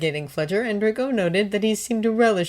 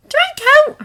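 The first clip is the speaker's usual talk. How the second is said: calling out; 10 dB louder than usual. A narrator's reading. Hermione calls after him.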